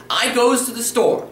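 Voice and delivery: wrong grammar demo. Shown as a demonstration. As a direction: raise the pitch to mimic a clumsy speaker saying "I goes to the store". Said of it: "You don't wanna sound like this".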